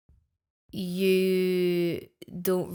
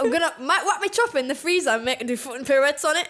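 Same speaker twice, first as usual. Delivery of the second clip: put-on Geordie accent; 5 semitones higher than usual. Geordie impression continues.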